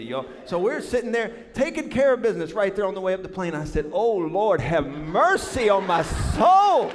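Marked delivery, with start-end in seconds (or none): 3.9-6.9 loud, slow, drawn-out plea; hammed-up cry for mercy, stretching the last word; desperate prayer. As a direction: wail to heaven in mock despair. Acted desperate prayer on plane.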